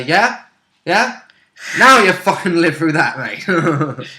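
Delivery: rising, goading challenge, then a loud jab; mock-aggressive taunt. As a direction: taunt the foe with pretend fury. Acted taunt while stabbing spider.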